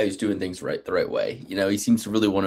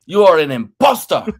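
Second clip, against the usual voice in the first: put-on high accented accusation, getting louder at the end; Hector Lombard impression; 9 semitones higher, 3 dB louder than usual. Host keeps imitating Hector Lombard, high accented accusation. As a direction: mimic Lombard calling out the imposter. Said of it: "He's like".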